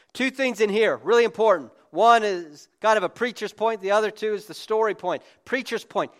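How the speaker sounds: loud, high sermon delivery; preaching at full force. Real, not acted. Pastor urges the church on politics, preaching hard. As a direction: drive the point home to the congregation.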